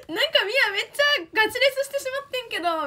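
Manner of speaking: high, fast, excited imitation of a friend; imitating Miyata. Retelling what Miyata said after the show, high and fast. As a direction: mimic her quick, high-pitched Kansai chatter. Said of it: "みたいな感じで言わ-、".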